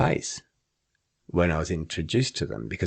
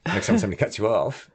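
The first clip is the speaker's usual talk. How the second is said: usual speaking voice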